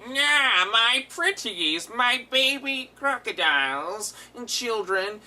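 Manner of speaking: high, slow, gloating villain voice; drawn-out villain croon; over-the-top villain. Retold or imitated. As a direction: ham it up like a cartoon sewer king. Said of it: "the guy who voices him is so over the top".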